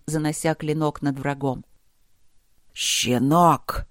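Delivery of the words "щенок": drawn-out, hissing contempt; venomous hiss at a young attacker; hissed insult. A narrator's reading. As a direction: hiss ЩЕНОК slowly through the teeth. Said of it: "прошипел Итхиркас".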